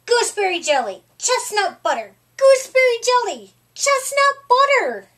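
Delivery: building to a high, loud, fast squeal; squabbling. A narrator's reading. Two friends argue over sandwiches, rising to a squeal.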